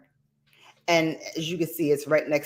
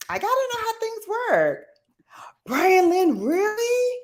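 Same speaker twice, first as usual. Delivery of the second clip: excited reaction. Real, not acted. Reacting to viewer comments.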